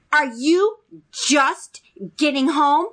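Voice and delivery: slow, high-pitched scolding demand; mock yell. Mother confronting daughter coming home late, mock yell. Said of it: "You don't have to yell".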